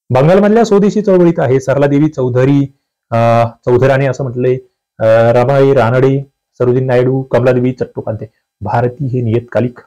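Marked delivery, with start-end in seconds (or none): none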